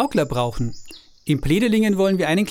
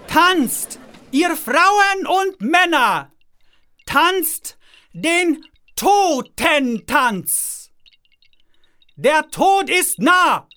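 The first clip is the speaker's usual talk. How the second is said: booming announcement; 10 semitones higher, 4 dB louder, much slower than usual. Acted. Jester announces the death dance.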